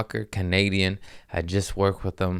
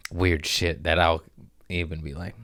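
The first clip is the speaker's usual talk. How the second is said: usual speaking voice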